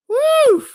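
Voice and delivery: high, loud "woo!"; real burst of excitement, high and loud; excited whoop. Real, not acted. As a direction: let out a big hype whoop. Host hypes himself up.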